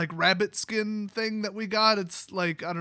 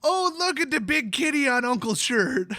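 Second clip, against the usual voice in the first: put-on excited higher toddler voice; little girl voice; 5 semitones higher, 3 dB louder than usual. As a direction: sound like a delighted small child pointing. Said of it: "the first thing she said was".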